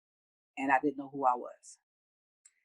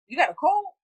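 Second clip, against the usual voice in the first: surprised question; 12 semitones higher, 7 dB louder than usual. Retold or imitated. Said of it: "I'm like".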